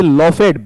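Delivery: "लॉफेड" as law-fed, two syllables; "लॉफेड" with a voiced d after a full e; wrong two-syllable reading. Shown as a demonstration. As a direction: say "लॉफेड" with a separate fed ending. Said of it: "बिल्कुल अलग हो गया".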